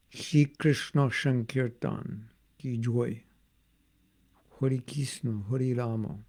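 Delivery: slow, rounded Bengali pronunciation; Bengali accent. Shown as a demonstration. Devotee demonstrating his accent to listeners, Bengali accent. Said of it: "That's my Bengali accent".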